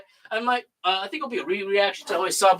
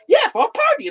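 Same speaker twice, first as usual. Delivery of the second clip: Jar Jar voice. Retold or imitated.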